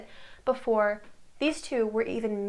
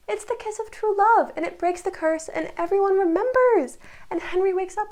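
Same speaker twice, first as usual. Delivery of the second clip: excited; 7 semitones higher, 5 dB louder than usual. Real, not acted. Gushing over the show's climax.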